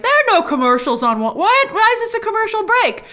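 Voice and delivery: high-pitched incredulous outburst, acted out; mock disbelief, voice shooting high; retold bafflement. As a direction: replay your baffled double take, pitch leaping up. Retells own baffled reaction to commercial.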